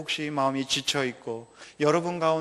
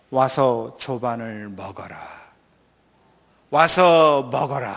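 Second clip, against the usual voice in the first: Jesus speaking; 4 semitones lower, much slower than usual. Acted. Preacher voices Jesus inviting.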